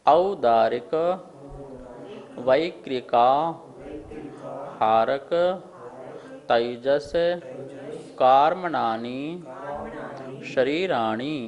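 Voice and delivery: chanted sutra recitation, word by word with pauses; leading a sutra chant; slow recitation. Shown as a demonstration. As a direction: recite each word slowly for the class to repeat. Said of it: "एक बार हम उच्चारण करेंगे".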